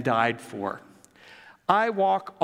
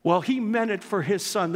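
normal talking voice

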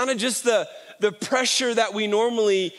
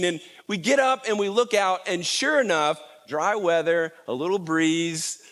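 ordinary talk, nothing marked

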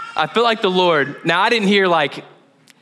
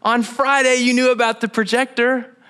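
higher firm voice of God; higher, firm rebuke to Josh; God's rebuke; 7 semitones higher than usual. Retold or imitated. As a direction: set him straight, firmly. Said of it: "He was like".

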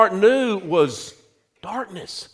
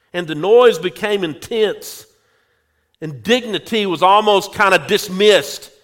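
ordinary talk, nothing marked

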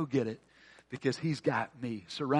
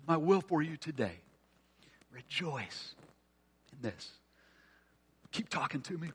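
the Lord's gentle whisper; 6 dB quieter than usual. Acted. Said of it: "hear the Lord whisper".

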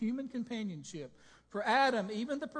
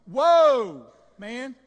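big loud whoa, settling on the last word; excited Adam; 4 semitones higher, 16 dB louder than usual. Acted. Preacher acting Adam amazed at the woman, excited. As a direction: belt out an amazed cheer. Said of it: "the excitement in Adam was so great".

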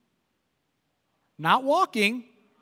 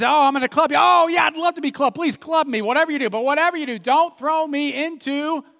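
high, louder, overeager mock begging; mock eager rabbit; 5 semitones higher, 4 dB louder than usual. Rabbit pretending to welcome the beating, high and eager. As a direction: ham up the delight of being clubbed.

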